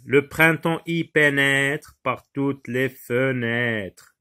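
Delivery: slow line, drawing out "pénètre" and "fenêtres"; deliberate verse reading that lingers on "pénètre" and "fenêtres"; stress on the rhyme. Shown as a demonstration. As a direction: stretch the matching endings of "pénètre" and "fenêtres". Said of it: "c'est sa musicalité".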